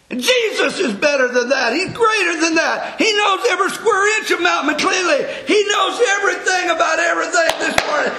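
full-throated sermon build; preaching. Acted. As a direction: lift it up to the congregation.

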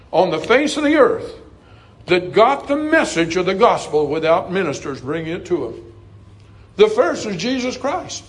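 high-pitched, driving pulpit delivery; ringing sermon crescendo; fervent preaching. Real, not acted. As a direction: preach it urgently, pitch climbing phrase by phrase.

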